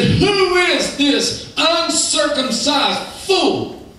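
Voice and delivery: high, drawn-out mock indignation; indignant challenge. David calls out the giant in the valley, indignant challenge. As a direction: stretch each word high in scornful disbelief.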